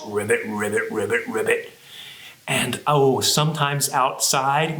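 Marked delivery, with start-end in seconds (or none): 0.1-1.7 very low frog croak; low-pitched, rhythmic croaking; big bullfrog. Pretend. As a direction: ribbit deep like a bullfrog. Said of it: "turning into big bullfrogs".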